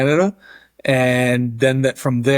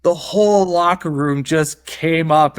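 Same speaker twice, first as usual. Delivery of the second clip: usual speaking voice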